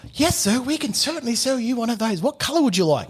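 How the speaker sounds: salesman voice. Retold or imitated. Car salesman greets a customer at the counter, high and eager. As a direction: play the chirpy car dealer greeting a buyer. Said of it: "the sales rep comes and goes".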